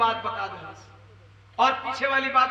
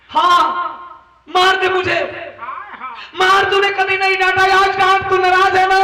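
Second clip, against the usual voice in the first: loud, higher anguished pleading; गुहार; 6 semitones higher, 8 dB louder than usual. Acted. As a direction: demand to be scolded, pained. Son pleads, begging mother's anger. (ऊँची, दर्द भरी गुहार)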